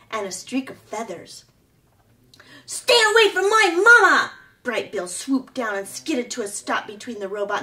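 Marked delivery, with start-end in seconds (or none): 2.6-4.3 high, loud cry of a little gosling; protective shout. A narrator's reading. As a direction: yell it to defend his mother. Gosling rushing to defend mother.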